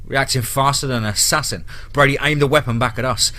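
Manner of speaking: ordinary delivery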